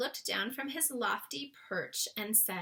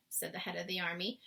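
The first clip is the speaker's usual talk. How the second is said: ordinary delivery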